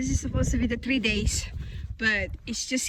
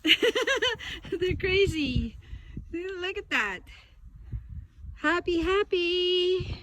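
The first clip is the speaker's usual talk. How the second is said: pet talk; 7 semitones higher, much slower than usual. Real, not acted. Cooing over the dogs.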